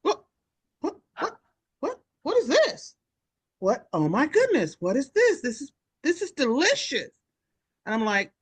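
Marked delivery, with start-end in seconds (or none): 0.0-7.1 put-on high, amazed old-lady voice; old patient amazed. Audrey tasting Tony's oatmeal: amazed high exclamation. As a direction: marvel at the taste like elderly Audrey. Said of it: "And Audrey went".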